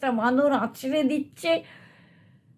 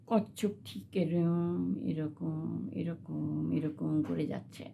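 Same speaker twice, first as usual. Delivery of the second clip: much softer, drawn out; soft slow narration; 5 semitones lower, 10 dB quieter than usual. A narrator's reading. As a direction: hush it like a slow crawl. Tortoise crawls slowly.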